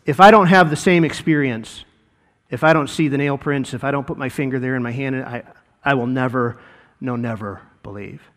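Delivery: hint of a sulky doubter; stubborn Thomas. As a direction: dig in quietly, won't budge. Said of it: "Thomas is like".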